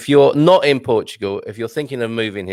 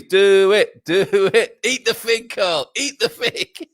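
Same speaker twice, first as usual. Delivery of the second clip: egging on; 9 semitones higher, slower than usual. Retold or imitated. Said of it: "and Sean agrees".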